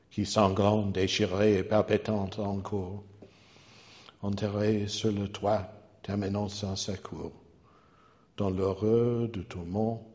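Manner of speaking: measured French verse reading; poem recitation. Acted.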